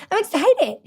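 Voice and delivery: high-pitched, laughing self-defence; excited tone. Retold or imitated. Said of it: "it's an excited tone of voice".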